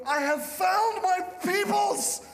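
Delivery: loud, slow, triumphant proclaim; overjoyed declaration. Retold or imitated. Fan among cheering crowd, loud and slow. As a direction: announce it like a homecoming. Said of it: "And I'm overwhelmed".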